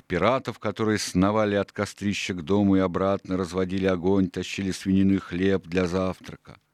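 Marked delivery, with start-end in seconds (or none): none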